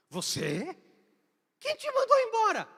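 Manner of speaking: high, loud indignant outburst; indignant boss. Retold or imitated. The boss outraged that his boy was fired, loud and high. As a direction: demand to know who dared. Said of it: "Ele olha pra mim e fala".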